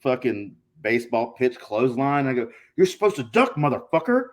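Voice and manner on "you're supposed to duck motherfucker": high mock yell, punching DUCK; retold angry yell. Retold yell during botched match.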